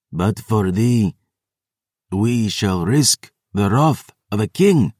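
slow, menacing hiss. A narrator's reading. Vampire threatening the wounded narrator, slow hiss. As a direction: hiss it slowly, relishing the threat. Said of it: "she hissed".

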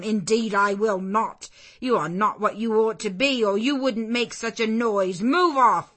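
loud, high scolding; angry refusal. Acted. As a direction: drive the stranger off. Servant angrily refusing.

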